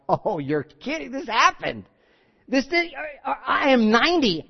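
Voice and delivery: rising from hushed wonder to high-pitched delight; acted happy astonishment; joyful amazement. Sarah at ninety seeing the promise happen, joyfully amazed. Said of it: "a genuine laughter of joy".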